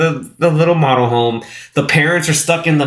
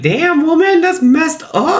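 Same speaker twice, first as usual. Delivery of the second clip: mock shocked outcry; 14 semitones higher, slower than usual. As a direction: squeal it out in disbelief. Own shocked reaction, acted.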